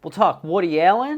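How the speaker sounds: high, loud announcer delivery; show host intro. Acted. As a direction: open the show big and high.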